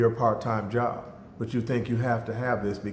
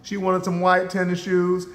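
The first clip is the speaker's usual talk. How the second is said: normal talking voice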